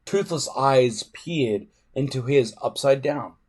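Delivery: ordinary delivery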